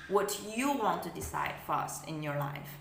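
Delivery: ordinary talk, nothing marked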